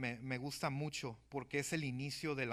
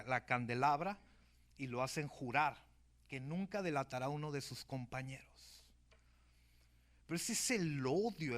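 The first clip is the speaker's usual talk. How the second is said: ordinary talk, nothing marked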